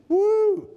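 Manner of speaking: excited preacher's whoop; high whoop. Real, not acted. Preacher whooping at the congregation, high whoop. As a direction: let out a high woo.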